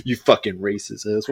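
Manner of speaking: low, soft insult under the breath; muttered insult. Pretend. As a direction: mutter it so he can't hear. Muttered insult after a friendly greeting.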